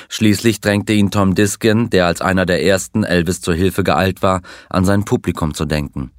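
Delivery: plain reading voice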